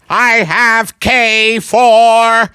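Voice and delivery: slow, stretched-out Italian alien voice. Acted. Italian alien announces his bingo, slow put-on accent. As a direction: draw every word out as an Italian alien.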